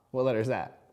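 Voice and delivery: usual speaking voice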